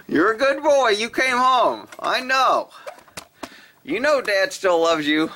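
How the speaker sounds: high, doting pet voice. Real, not acted. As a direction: praise him gently for coming home. Praising cat, pet voice.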